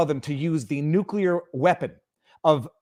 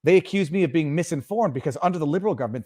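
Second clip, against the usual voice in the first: ordinary talk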